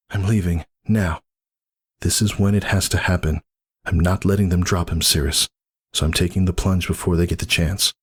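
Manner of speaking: lightly voiced, set on leaving; determined Whisper. Whisper tells Cirrus she's leaving, lightly voiced and firm.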